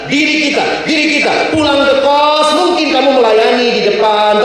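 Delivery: high, insistent sermon delivery; impassioned preaching. Real, not acted. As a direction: drive the point home to the congregation. Preaches at full force.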